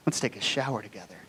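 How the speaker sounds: low, hushed, quick suggestion; soft invitation. Retold or imitated. Girlfriend inviting him to shower together, soft and low. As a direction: float it very quietly as an invitation.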